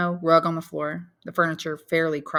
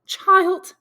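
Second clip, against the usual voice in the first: a high, sighing mother's cry; 10 dB louder than usual. Acted. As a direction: sigh the word out to your son. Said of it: "sighed Mercédès".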